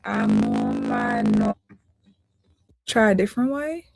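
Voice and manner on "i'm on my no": distorted, low, warped stream audio; broken mic, voice dropping out; glitchy audio. Real, not acted. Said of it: "is it not sounding good".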